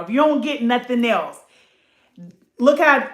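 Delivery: ordinary talk, nothing marked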